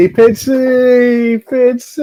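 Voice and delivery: high, loud drawn-out call; loud playful hail. Real, not acted. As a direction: sing out his name across the room. Calling to chatter Pitsy.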